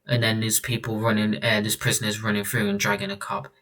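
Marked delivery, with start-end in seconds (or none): none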